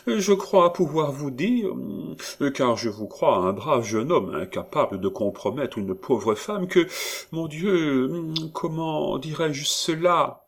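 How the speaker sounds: coy housekeeper playing shy; en minaudant. A narrator's reading. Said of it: "en minaudant". (voix minaudière de Perrine)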